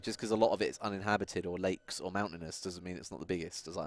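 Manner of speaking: ordinary delivery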